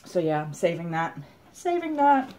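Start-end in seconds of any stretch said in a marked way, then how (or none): 1.5-2.3 squeaky delight. Real, not acted. Delighted echo to herself.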